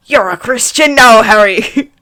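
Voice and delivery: loud, proud exclamation; hammed-up triumphant pride; proud cry. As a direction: proclaim it proudly, nearly laughing. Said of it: "Hagrid cried proudly".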